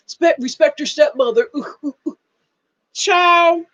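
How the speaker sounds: mock scolding.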